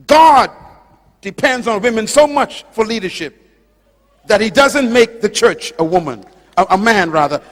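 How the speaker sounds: loud, forceful sermon delivery; emphatic preaching. Real, not acted. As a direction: drive the point home to the congregation, loudly.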